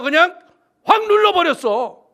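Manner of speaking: sudden loud burst; forceful press. Retold or imitated. Preacher retelling the pressing, loud burst. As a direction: shove the words out like pressing down hard. Pastor presses the crippled legs.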